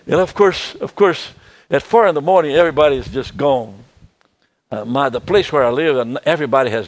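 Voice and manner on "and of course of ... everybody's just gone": usual speaking voice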